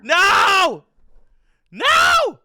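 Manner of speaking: high, loud anguished scream; sudden shriek of despair; panicked scream. Real, not acted. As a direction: cry out as everything goes wrong. Real panic at game death.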